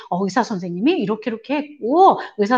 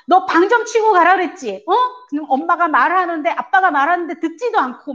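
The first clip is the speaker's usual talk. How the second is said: angry nagging mother; 6 semitones higher than usual. Retold or imitated. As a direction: nag the child furiously. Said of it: "화를 내면서 그렇게 막 짜증을".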